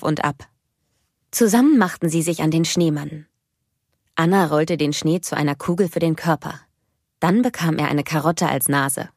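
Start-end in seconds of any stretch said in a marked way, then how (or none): none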